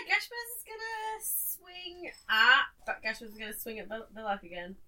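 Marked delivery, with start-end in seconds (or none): 0.1-2.6 high-pitched, very slow announcement; slow, high wind-up to the attack; drawn-out build-up. Real, not acted. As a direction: stretch out "swing at" for suspense.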